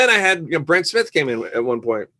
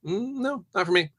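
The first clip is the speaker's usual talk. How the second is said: soft, high hum of mild refusal; casual refusal; 8 semitones higher, 5 dB quieter than usual. Acted. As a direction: turn it down lightly, no drama. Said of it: "You just get".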